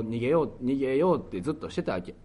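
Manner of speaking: ordinary talk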